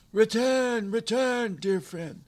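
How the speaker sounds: desperate high-pitched calling; loud shout. A narrator's reading. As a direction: call him back at full volume, very high. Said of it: "shouting loudly to the soldier".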